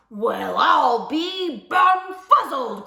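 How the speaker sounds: slow, loud, bewildered growl; growling amazed bear. A narrator's reading. Great big bear scratches head in amazement, growling. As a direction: growl it out in astonishment. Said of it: "growled the great big bear".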